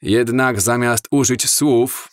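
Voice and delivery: ordinary delivery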